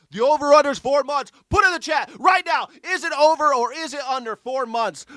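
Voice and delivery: high, loud hype announcer shout; excited bet call. Real, not acted. Streamer rallying the chat to bet on four months, excited shout. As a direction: fire up the crowd at full volume. Calling bets from the live chat.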